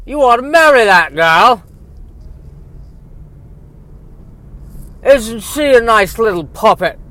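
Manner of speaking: high, loud simpering servant voice; fawning house elf. Acted. House elf gushing to the hero about his love interest, high and simpering. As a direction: grovel to the master like a loyal house elf. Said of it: "the love interest's house elf".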